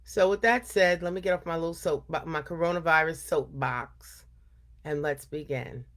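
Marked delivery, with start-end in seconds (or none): none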